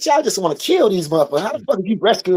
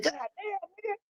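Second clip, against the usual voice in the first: shocked exclaim; 13 semitones higher, 18 dB quieter, slower than usual. Retold or imitated. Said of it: "I'm like".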